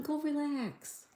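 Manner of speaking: tender coo. Real, not acted. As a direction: coo it gently like to a pet. Telling sick viewer to rest.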